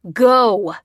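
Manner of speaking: longer, full-force angry shout; roared. A narrator's reading. Aggressor shoving the old man out, roaring. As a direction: roar the order out. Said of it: "roared the aggressor".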